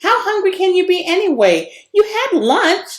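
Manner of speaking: lightly voiced kid griping about her lunch; complaining. A narrator's reading. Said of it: "Trey complained".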